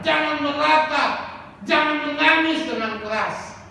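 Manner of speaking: loud, high preaching shout; quoted emphatic command. Retold or imitated. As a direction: call out the quote as a stern order. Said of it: "Tapi Yesus lagi bilang". (kutipan perintah diserukan lantang)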